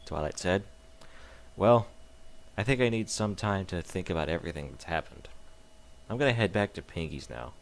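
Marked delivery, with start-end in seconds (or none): none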